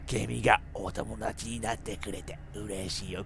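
low, soft creepy doll voice. Acted. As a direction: murmur it like a haunted doll.

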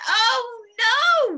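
high, loud wail of dismay; children crying out. A narrator's reading. Children seeing their snowman melted, loud mock dismay. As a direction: cry it out high, stretching the no. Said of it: "the children cried".

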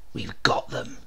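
soft breathy whisper; whispered under the breath; murmured. A narrator's reading. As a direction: hush it like a man lying in wait. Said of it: "murmured the brigadier".

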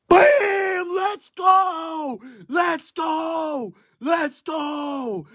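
ecstatic high shouts; excited shouting. Real, not acted. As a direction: scream with joy at the hit. Big pack hit celebration.

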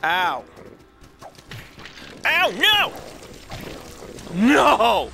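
sudden high yelp of pain, loud. Real, not acted. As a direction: cry out as you get hit. Hurt by enemies in game.

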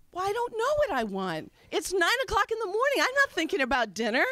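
exasperated daughter. Retold or imitated. Exasperated reply to mother.

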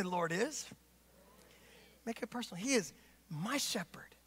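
very soft, dropping into a whisper; hushed reverence. Acted. Preacher leading congregation in the psalm, hushed reverence. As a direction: let it sink to a private whisper.

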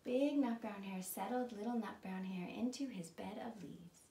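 plain reading voice

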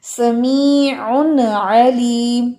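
समीउन with the noon said clearly before अलीम, slow. Shown as a demonstration. Teacher showing the noon sakina clearly. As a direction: pause lightly and let the noon ring in समीउन. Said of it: "नून साकिना की".

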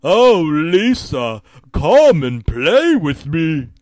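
loud, drawn-out taunting call; slow, sing-out villain scream. A narrator's reading. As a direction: call out slowly and loudly, like a killer luring a victim. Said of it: "He screams".

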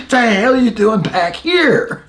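chuckling as he retells it; laughing. Real, not acted. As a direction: quote broken up by real laughter. Laughing while retelling officer's line.

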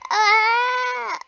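high loud startled cry; tripping and falling. Real, not acted. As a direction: cry out as you fall. Ow, I slipped and fell.

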